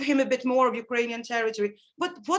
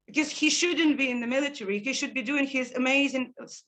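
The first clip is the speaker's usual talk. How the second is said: ordinary delivery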